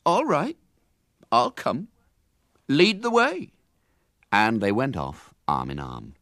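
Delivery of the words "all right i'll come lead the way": higher, lordly consent; gracious lunatic. Acted. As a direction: grant it like royalty. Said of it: "bowed graciously and said".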